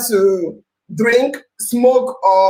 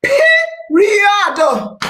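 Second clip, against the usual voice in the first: high emphatic "Period."; 9 semitones higher, much slower than usual. Real, not acted. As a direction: slam the point shut. Emphatic closing word.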